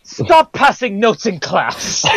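high, slow, scolding teacher; crisp disapproving governess. Retold or imitated. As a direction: tell off a pupil, slowly. Mimicking Q as teacher.

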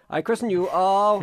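solemnly christen the baby in a mock-holy voice. Host mock-christens a listener's odd username, hammy vicar voice.

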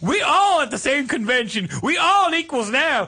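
high, indignant Appalachian twang; mock Appalachian, lecturing; Appalachian woman. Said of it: "she had to be from, like, freaking Appalachia".

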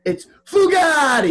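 high, drawn-out shout of a nickname; mock exclamation. Crying out a friend's widely known nickname, exclaiming. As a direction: belt out the name, stretched and high. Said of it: "everyone knows him as Fugatti".

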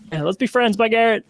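high, bright send-off; gushing goodbye to a star; giddy farewell. Retold or imitated. Fan gushing a high excited goodbye to Garrett.

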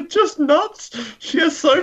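high, helpless giggly delivery; laughing through words. Real, not acted. Host cracking up about a silly Bond girl, laughing. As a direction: crack up while getting it out. Cracking up over Tiffany's scenes.

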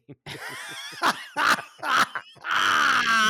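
loud, high burst of laughter; big laugh. Real, not acted. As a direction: crack up loudly at your own joke.

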